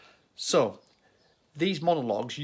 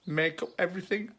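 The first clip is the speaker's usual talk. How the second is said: higher, quicker repeat of the punchline; prompting the punchline; 6 semitones higher, faster than usual. Real, not acted. Teacher re-explaining the atoms joke, higher and quicker. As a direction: nudge them to get it, pitched up.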